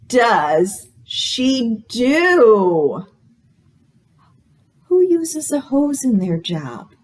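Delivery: bright, sing-song storytime read; reading to children. Acted. Librarian reading a guessing book to children, sing-song. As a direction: read it like a picture book to little kids.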